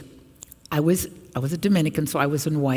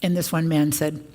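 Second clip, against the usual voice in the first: normal talking voice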